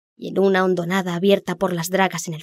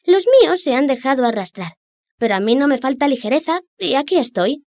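high, boastful fish voice; brag, pitched up; cocky little fish; 5 semitones higher than usual. Acted.

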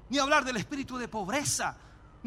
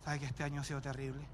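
much lower and softer, a weary lament; a parishioner whining to the pastor about a terrible year; complaining parishioner; 9 semitones lower, 12 dB quieter than usual. Retold or imitated. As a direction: moan it like a tired sick believer. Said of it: "hermanos que me dicen".

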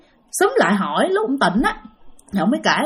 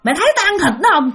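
higher, fast gloating ghost voice; taunting ghost; 6 semitones higher, faster than usual. Acted. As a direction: taunt him quickly while chewing. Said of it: "Cái nói".

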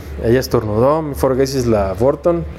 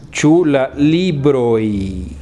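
very slow, each word separate, stressing chu; slow correction; much slower than usual. Shown as a demonstration. Teacher corrects the student's question slowly. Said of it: "la pregunta debe ser".

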